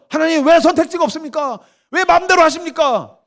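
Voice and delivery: very high-pitched wailing complaint; indignant protest. Acted. Mother protests to God about having no choice, indignant. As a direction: plead against an unfair decision.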